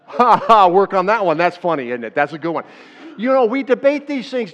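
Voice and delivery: loud, amused chuckle-talk; enjoy the joke with the room; laughing along. Real, not acted.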